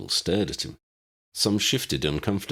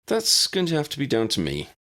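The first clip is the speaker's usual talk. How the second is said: airy; 10 semitones higher, 3 dB louder than usual. A narrator's reading. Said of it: "Dennis said in an airy voice".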